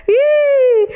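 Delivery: loud high burst of laughter. Real, not acted. Teacher bursting into laughter at her own joke, loud laugh.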